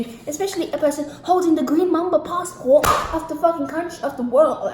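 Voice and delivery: loud, high-pitched furious tirade; outraged rant. Real, not acted. Venting at official over missing information, outraged. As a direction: explode on the first word, then rant loudly.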